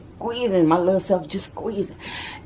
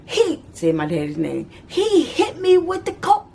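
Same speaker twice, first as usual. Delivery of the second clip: upset mama; 10 semitones higher than usual. Retold or imitated. Mama telling the family who hit her, high and upset. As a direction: complain it, still shaken. Mama explaining through pain.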